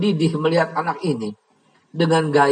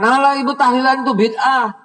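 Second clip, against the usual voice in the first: high, loud sneering mimic of a know-it-all teen; mocking arrogant child; 9 semitones higher, 4 dB louder than usual. Mother retelling her son's sneering, mocked in a high, loud voice. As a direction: sneer at mother like a cocky kid. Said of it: "dengan mengatakan".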